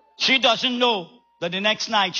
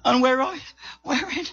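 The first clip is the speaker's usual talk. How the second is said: high, broken, mock-breathless Delilah; playing out of breath; 4 semitones higher than usual. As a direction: falter as if feigning breathlessness. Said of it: "she out of breath".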